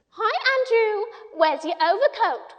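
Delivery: high, loud, windy mimicry of a fussy lady; high windy Miss Lark voice. A narrator's reading. Michael mimicking Miss Lark, high and windy. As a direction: put on a high windy voice to tease the dog. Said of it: "trying to adopt a high, windy voice like Miss Lark".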